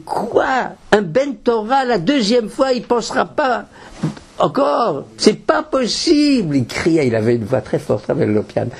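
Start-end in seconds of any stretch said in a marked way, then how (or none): 0.0-6.6 high, outraged mock shout of a rabbi; indignant cry. Teacher retelling Rabbeinu Lopian's cry at his students, high indignant shout. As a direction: cry out in disbelief. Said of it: "il a crié".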